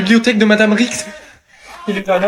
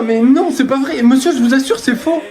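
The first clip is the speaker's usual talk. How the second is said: pleading protest; 7 semitones higher than usual. Acted. Student denies accusation to teacher.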